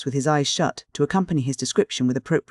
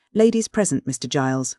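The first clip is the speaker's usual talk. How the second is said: ordinary delivery, nothing marked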